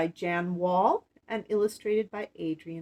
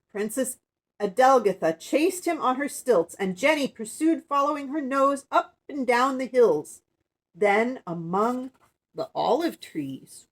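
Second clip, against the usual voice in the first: loud, animated read-aloud; storybook reading; 4 semitones higher, 7 dB louder than usual. Acted. Woman reading the chase on stilts, animated storybook narration. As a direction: carry children along through the chase.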